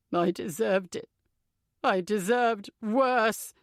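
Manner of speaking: slow, choked, tearful; slowly, a mild tearful break; in tears. A narrator's reading. Tom confesses his guilt to Emily, slow and tearful. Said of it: "Tears are streaming down his face".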